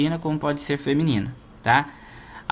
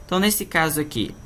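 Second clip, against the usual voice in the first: normal talking voice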